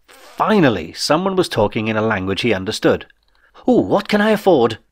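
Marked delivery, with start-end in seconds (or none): 3.7-4.8 higher, eager "Ooh"; greedy excitement. Acted. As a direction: rub your hands at the thought of money. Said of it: "greedy anticipation".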